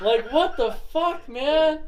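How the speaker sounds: high, loud, slow and baffled; baffled outburst. Retold or imitated. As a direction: stretch it out in disbelief. Retold confused outburst.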